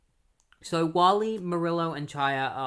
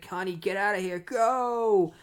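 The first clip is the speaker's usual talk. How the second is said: higher, urgent, last word shouted; urgent plea to flee; 6 semitones higher, 4 dB louder than usual. Retold or imitated. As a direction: drive her away to save her. Wounded Chester urges her away.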